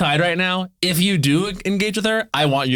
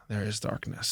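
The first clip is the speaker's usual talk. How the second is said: low, very soft ominous pronouncement; theatrical doom; 6 semitones lower, 14 dB quieter than usual. Retold or imitated. Psychic reading tarot cards after a pause, hushed theatrical doom. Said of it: "giving, like, the cuntiest performance".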